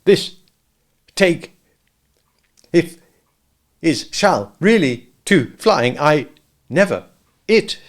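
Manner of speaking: broken up word by word with gaps; jerky. A narrator's reading. Piglet bouncing at the bottom of Kanga's pocket, jerky. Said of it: "he thought jerkily to himself".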